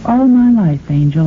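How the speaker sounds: normal talking voice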